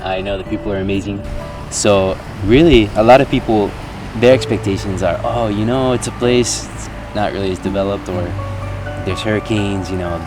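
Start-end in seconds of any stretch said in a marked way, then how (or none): none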